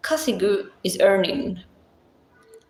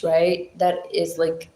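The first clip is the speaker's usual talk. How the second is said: ordinary talk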